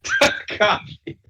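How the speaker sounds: high squeaky laughing Takashi; mock the slip through laughter; laughing mock repeat. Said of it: "I said Takashi".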